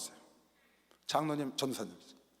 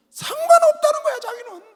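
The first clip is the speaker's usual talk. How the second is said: much higher and louder, astonished; amazed exclamation; 6 dB louder than usual. Real, not acted. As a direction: blurt it in disbelief. Amazed retelling of girl. (놀라서 높이 외치듯)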